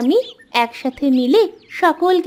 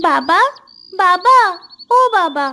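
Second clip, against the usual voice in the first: repeated call, growing louder; 2 semitones higher, 3 dB louder, slower than usual. Acted. Daughter calls her father over, calling out insistently. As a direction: call for a parent in another room.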